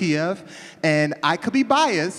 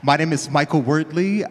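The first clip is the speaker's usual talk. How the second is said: normal talking voice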